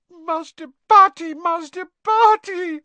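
very high, loud sobbing elf cry. A narrator's reading. Winky sobs her master's name through her hands. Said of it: "sobbed Winky through her hands".

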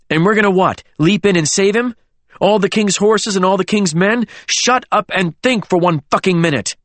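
high, scornful tirade; exasperated outburst. A narrator's reading. Alexander argues against leaping in, exasperated. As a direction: shout down the panic. Exasperated quarrel over rescue.

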